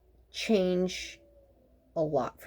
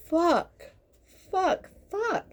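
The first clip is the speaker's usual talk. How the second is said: exasperated cursing; 11 semitones higher, much slower than usual. Retold or imitated. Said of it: "you're just like".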